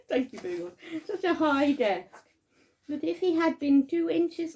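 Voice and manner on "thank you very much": quick aside through a laugh; real laughter. Real, not acted. Narrator laughing at their own slip, real amused aside. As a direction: break up laughing mid-reading, higher and fast.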